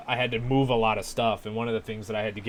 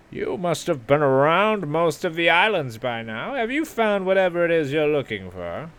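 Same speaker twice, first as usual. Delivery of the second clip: higher, questioning game-character voice; raised voice, probing the newcomer; questioning lighthouse keeper; 6 semitones higher, 4 dB louder than usual. Acted.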